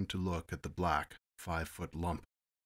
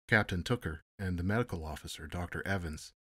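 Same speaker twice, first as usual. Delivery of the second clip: usual speaking voice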